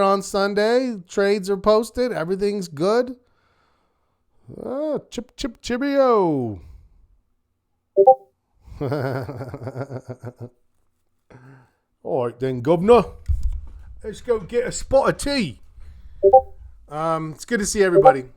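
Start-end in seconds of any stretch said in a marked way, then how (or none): 4.6-6.6 mock British. Jokey British sign-off. 12.0-15.6 mock British. Jokey British sign-off.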